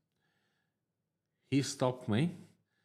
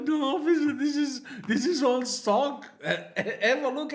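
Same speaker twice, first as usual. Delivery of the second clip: laughing protest; 6 dB louder than usual. Retold or imitated. Laughing denial to officer.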